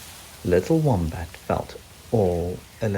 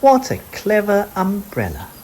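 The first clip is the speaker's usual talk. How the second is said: high, playful mum searching; Mum's voice; 11 semitones higher, 3 dB louder than usual. Acted. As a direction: pretend you can't find him. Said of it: "Mum teasing".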